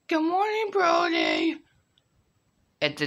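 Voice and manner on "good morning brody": put-on squeaky kid voice. High kid voice greeting.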